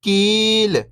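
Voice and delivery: whole word, level then falling at the end; syllables blended. Shown as a demonstration. Teacher showing beginners the joined word, syllables blended. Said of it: "तो मिलके बन जाएगा".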